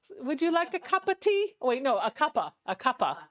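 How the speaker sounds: high-pitched mock-British greeting; sophisticated British accent. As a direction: put on a refined British tea-time voice. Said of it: "voice in my head is so sophisticated".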